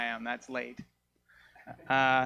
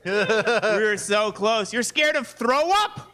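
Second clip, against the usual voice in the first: high, slow, loud cartoonish whine; a whiny little creature pleading to stay; dick voice; 11 semitones higher, 5 dB louder than usual. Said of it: "My dick was like".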